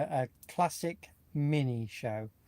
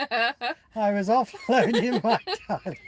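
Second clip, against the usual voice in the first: slow, laughing through the quote, louder and higher; laughing while quoting; 8 semitones higher, 7 dB louder, slower than usual. Retold or imitated. Friend repeating the funny line on the live stream, laughing. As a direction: savour the line between giggles. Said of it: "Got me chuckling now".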